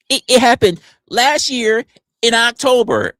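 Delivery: high, loud put-on answer; mock indignant accuser. Accuser dating the incident for streamer, high indignant imitation.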